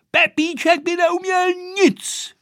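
put-on high-pitched childish cry; exclaimed outburst. A narrator's reading. Vojta finally finding his objection to Pepíček, crying out. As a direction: burst out with it, voice pitched right up. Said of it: "vykřikl nakonec".

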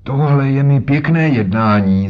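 put-on an old man exclaiming in outrage; indignant old man. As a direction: raise the voice in offended protest. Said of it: "zvolal a třískl holí".

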